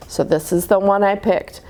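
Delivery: ordinary delivery, nothing marked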